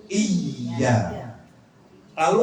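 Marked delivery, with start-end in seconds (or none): 0.1-1.0 Iya with a long held y, iy-ya; doubled y. Shown as a demonstration. Teacher repeats the right reading, doubled letter. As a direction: lean slowly on the y in Iya. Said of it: "fungsinya menggandakan huruf".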